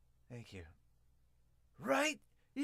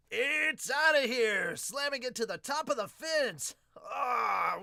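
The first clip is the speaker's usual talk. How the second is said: loud, higher, slow 'outta here' burst into a yell; excited home run call; 10 semitones higher, 9 dB louder, slower than usual. Real, not acted. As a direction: call the homer like the crowd's on its feet. Home run reaction while reading.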